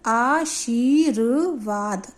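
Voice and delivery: आशीर्वाद drawn out very slowly in separate syllables. Shown as a demonstration. Teacher reads out the newly formed word for pupils, syllable by syllable. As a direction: spell out आशीर्वाद by sound, aa-sheer-vaad, very slowly. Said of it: "तो शब्द आपका लिखा जाएगा इस तरह से".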